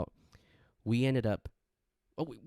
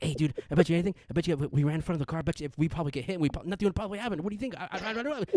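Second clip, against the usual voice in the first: rapid caffeinated rambling, climbing higher; imitated shaky buzz; 6 semitones higher, much faster than usual. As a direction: babble like you're wired on espresso. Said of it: "we're, like, shaky".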